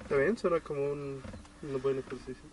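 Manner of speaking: barely audible, far too soft; mumbled almost inaudibly; speaking too softly. Real, not acted. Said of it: "Habla más fuerte".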